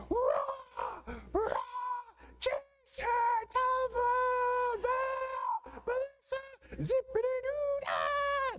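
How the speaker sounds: squawking parrot voice, very high; parrot imitation. Parrot squawking the kids' names at dawn, high screech. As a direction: screech it out like a parrot, drawn out slow. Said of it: "And Humphrey would go".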